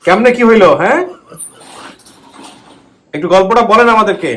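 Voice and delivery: loud, high coaxing questions; to someone hard of hearing. Real, not acted. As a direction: project to a listener who can't hear. আনো কম শোনেন.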